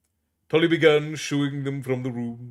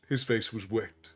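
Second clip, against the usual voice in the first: ordinary delivery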